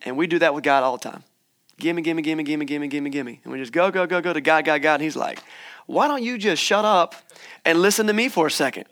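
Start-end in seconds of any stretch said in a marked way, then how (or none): 1.8-3.4 rattled-off mock pleading, trailing down; mock greedy begging. Mimics people demanding things from God. 5.9-8.9 mock exasperation. Voices an exasperated God cutting in.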